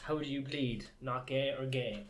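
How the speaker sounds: normal talking voice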